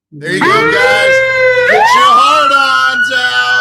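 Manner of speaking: loud high synthetic shout; robotic voice yelling. Real, not acted. AI voice shouting playback.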